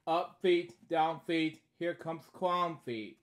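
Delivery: lively sing-song read-aloud; storybook reading. Acted. Reading a bedtime story aloud, lively. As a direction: read each line brightly to a child.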